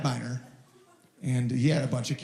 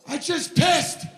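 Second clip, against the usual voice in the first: much higher, slow triumphant yell; proud shout; 13 semitones higher, slower than usual. Retold or imitated. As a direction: bellow it like a victory. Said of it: "every time I piss outside".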